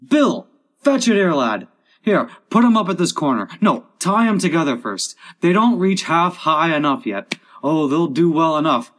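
high, loud workmen's bustle; shouted instructions. Acted. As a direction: call orders across the crew. Crew shouting instructions at work.

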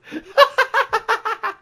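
very high, slow, sobbing cartoon wail; Barney voice. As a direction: drag it out in a crying cartoon squeal. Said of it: "Do it as Barney".

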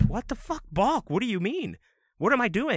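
high-pitched, exasperated mock whine; frustrated protest. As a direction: protest the call in high-voiced disbelief. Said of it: "And I'm getting frustrated".

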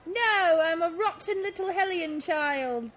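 put-on defiant child in light Cockney. Naughty child refusing mother, lightly put-on Cockney. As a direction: play a bratty Cockney kid. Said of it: "'cause I'm definitely Cockney".

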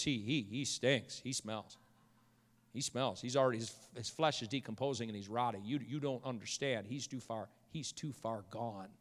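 very soft, low, halting; hushed despair. Acted. Martha telling Jesus her brother is gone, hushed despair. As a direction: voice a hopeless protest, sinking almost to nothing.

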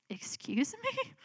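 high, slow, incredulous; indignant "excuse me". Retold or imitated. Mom shocked at daughter's refusal, slow and incredulous. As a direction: draw it out in mock disbelief.

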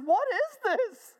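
very high, slow, loud overwhelmed voice; imitated tearful disbelief. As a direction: play her stunned and starting to cry. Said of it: "she starts to cry".

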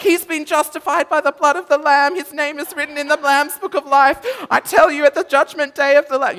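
high, very loud, commanding the devil out; loud fervent prayer. Retold or imitated. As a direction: bellow the prayer like a street preacher casting out a demon. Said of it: "you know, like this".

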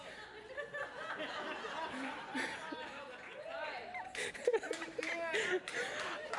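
high giggling laugh. Real, not acted. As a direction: burst out laughing at yourself. Laughs at his own accent.

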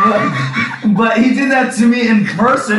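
high, loud, laughing outburst; laughing shout. Real, not acted. Telling a friend about his dad, laughing loudly. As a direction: shout it while cracking up.

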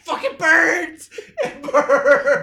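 slow, high, laughing whine; laughing mock pain. Retelling Beastman's ammonia burn, laughing and whining. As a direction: whimper about the burn through laughter. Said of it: "He's like".

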